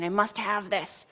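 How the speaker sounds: slow voice of a needy, fearful self; fear-based self. Retold or imitated. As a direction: draw it out as the grasping self. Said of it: "just a fear-based me saying".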